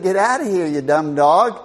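annoyed shooing. Retold or imitated. Half-asleep owner to his barking dog, mock annoyed. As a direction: shoo the dog off with a loud retold grumble.

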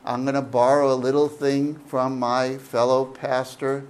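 usual speaking voice

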